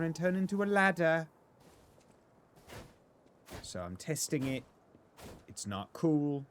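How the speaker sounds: hammed-up funny voice rising toward falsetto; silly put-on voice. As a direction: stretch the line ends in a comic character whine. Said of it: "talking like this".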